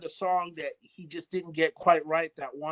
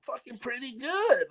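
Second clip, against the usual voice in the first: higher-pitched, drawn-out impressed reaction; pleasantly surprised; 8 semitones higher, slower than usual. Retold or imitated. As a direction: act out hearing your old work with surprise. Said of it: "sometimes I get surprised".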